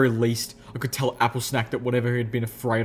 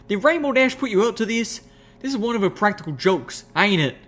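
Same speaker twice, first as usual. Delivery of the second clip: high suspicious country voice; suspicious country mare; 10 semitones higher than usual. Acted. Applejack suspecting a prank, high suspicious voice. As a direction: needle her, pitched high.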